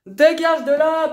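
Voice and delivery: real angry yell; furious shout. Real, not acted. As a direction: bark her out of the room. Shoving match in the bedroom.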